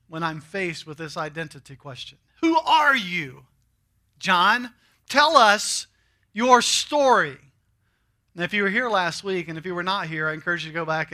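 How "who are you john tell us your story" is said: a hint of a pushy questioner, higher and louder; questioners pressing John. Preacher voicing crowd pressing John, mildly insistent. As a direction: press him, demanding his story.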